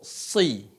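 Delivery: SI with a heavy s, i leaning toward O; si tebal. Shown as a demonstration. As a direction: darken the vowel in "si". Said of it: "I-nya pun mengarah ke huruf O". (ucapkan "si" tebal, i mengarah ke O)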